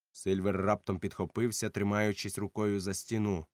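usual speaking voice

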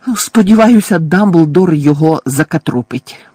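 high, loud angry growl; old neighbour fuming about the thief; furious bark. A narrator's reading. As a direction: bark it with venom. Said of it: "розлючено гаркнула місіс Фіг".

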